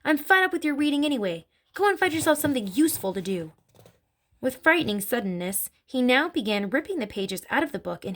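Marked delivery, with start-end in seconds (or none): 0.0-3.5 higher-pitched, mildly barked reprimand. A narrator's reading. Father orders his daughter off reading, barking mildly. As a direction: bark it lightly, as a fed-up father. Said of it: "Mister Wormwood barked".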